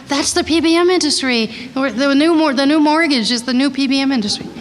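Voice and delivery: high-pitched mock outburst at the movie; retold yelling out. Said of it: "I was screaming out".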